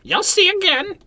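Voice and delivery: the bird warns the party, high and loud; talking bird. Acted. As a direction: squawk the warning like a parrot.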